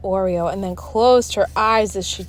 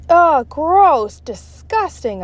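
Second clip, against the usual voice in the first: disgusted; 8 semitones higher, 6 dB louder, much slower than usual. Acted. Kid tastes toothpaste.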